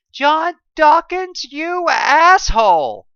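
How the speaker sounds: high, loud, slow furious yell; angry shout. A narrator's reading. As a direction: yell it through a speaker. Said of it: "I shouted through my collar".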